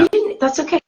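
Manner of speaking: high-pitched soothing pet voice; sing-song high reassurance; talking to a cat. Acted. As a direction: comfort a pet that made a mess. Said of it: "That's how I talk to my cat".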